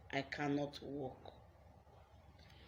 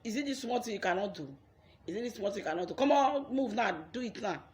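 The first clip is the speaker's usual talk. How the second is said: fast, higher, urging someone on; rally them like a coach: come on, move; pushy encouragement; 5 semitones higher, 4 dB louder, faster than usual. Pretend. Friend urging her to move, pushy and encouraging. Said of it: "just tell me".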